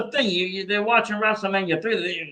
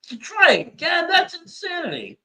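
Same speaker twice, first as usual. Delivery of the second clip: much higher, incredulous shout on the retry; exasperated outburst; 11 semitones higher than usual. Real, not acted. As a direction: burst out in disbelief at the second attempt. Exasperated about the wrestler's retry.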